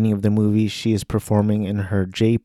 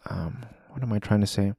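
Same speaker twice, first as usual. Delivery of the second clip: whispered aside; 8 dB quieter, faster than usual. Real, not acted. Vlogger losing a word mid-review, whispered aside. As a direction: whisper it to yourself, a bit fast.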